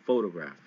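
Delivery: photograph, PHO-tograph, first syllable strong; stress on PHO. Shown as a demonstration. Teacher modeling photograph for the class, PHO stressed. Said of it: "pho, really hard".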